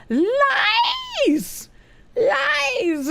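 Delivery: high, drawn-out falling wail; hammed-up accusing howl; mock outraged cry. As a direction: cry it out in theatrical disbelief.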